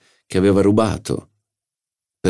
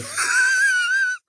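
a short pleased laugh in a high voice; amused laugh. Acted. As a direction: laugh at your own witticism. Schie laughs at own joke.

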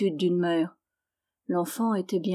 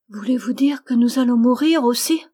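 put-on higher, louder little-girl voice; childlike startled question; questioning child; 4 semitones higher, 6 dB louder than usual. A narrator's reading. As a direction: ask urgently like a small child. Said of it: "demanda l'enfant".

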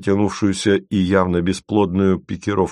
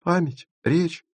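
persisting; 11 semitones higher, slower than usual. A narrator's reading. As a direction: offer each word slowly in a small high voice. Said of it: "упорствовала Маша".

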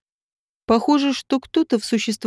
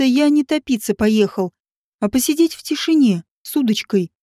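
ordinary delivery

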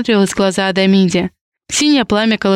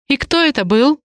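higher-pitched, mildly troubled question; puzzled, raised-pitch query; frowning question; 6 semitones higher than usual. A narrator's reading. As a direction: ask it with a light frown. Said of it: "нахмурилась Ри".

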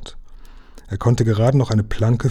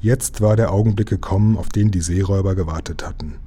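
ordinary delivery, nothing marked

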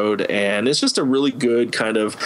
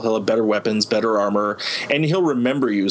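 ordinary delivery

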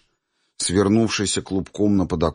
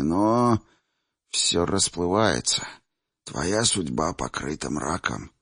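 put-on old man voice; 3 dB quieter than usual. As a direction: speak softly as an old man. Old seer Chimir speaks.

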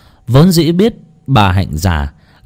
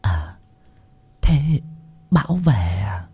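put-on soft, feeble old woman catching on; hushed, slightly puzzled elderly murmur; dawning realization; 2 semitones lower, 6 dB quieter, slower than usual. A narrator's reading. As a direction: let the news sink in quietly. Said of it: "đoạn ả lên một tiếng".

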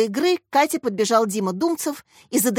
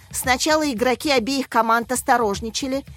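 ordinary delivery

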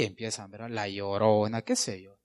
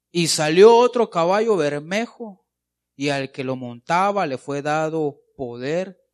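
loud scripture reading; 9 dB louder than usual. Real, not acted. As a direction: boom out the verse, slowing at the end. Preacher reads scripture aloud. (lectura bíblica proclamada en voz alta)

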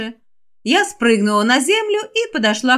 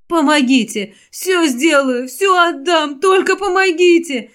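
pleading, crying; 6 semitones higher than usual. Acted. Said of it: "Взмолилась женщина, а затем разрыдалась".

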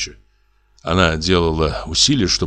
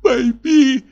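desperate sobbing plea; much slower than usual. Acted. Wife in hysterics pleading with husband, much higher sobbing plea. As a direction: force the words out through tears. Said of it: "могла только выговорить".

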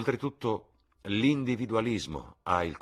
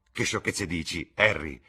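mildly indignant, louder on the name; exclaimed protest; 2 semitones higher, 7 dB louder than usual. A narrator's reading. Boy dismisses his friend's boast, mild loud exclamation. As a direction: scoff aloud, punching the last word. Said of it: "esclamò il ragazzo".

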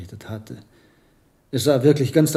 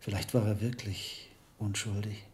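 quiet regret; 5 semitones lower, 12 dB quieter than usual. Acted. Admitting possible guilt over dead novice.

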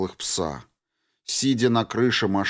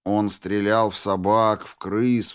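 ordinary delivery

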